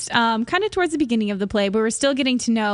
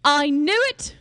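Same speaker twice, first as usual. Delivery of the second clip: higher British exclamation; British accent; 4 semitones higher, slower than usual. Acted. Son reacting to mother's news, higher British accent. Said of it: "we can do British".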